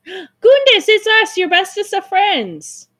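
high, loud, drawn-out hello to friends; excited greeting. Pretend. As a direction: greet long-missed friends in a high pitch.

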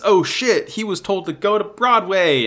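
lightly panicked "oh shit" moment; retold sudden realization. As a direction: act out realizing the mistake.

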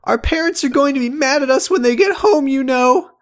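very high fast warning; high character voice. Acted. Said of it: "I said".

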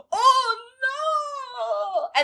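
high, loud, drawn-out mock wail; mock dismay. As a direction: ham up a long theatrical cry of dismay. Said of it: "And he goes".

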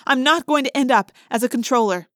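plain reading voice

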